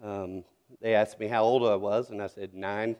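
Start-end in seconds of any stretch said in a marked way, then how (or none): 2.5-3.0 put-on nine in his Southern accent. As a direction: answer "Nine" with a natural Southern vowel. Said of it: "tease me about my accent".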